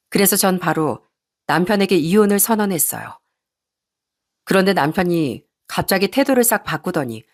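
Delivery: ordinary delivery, nothing marked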